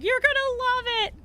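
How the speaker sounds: high sing-song promise; excited quote. Retold or imitated. Creator hyping the streamer before the hot lap, high. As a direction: tease her excitement, high. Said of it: "and she was like".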